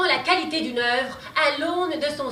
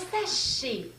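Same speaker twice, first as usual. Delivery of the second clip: ordinary delivery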